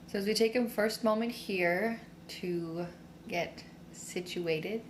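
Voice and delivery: slow, soothing meditation voice; guided meditation. Real, not acted. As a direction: guide them inward slowly, with long pauses. Leads a standing meditation.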